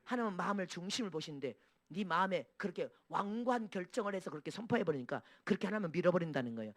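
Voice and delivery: usual speaking voice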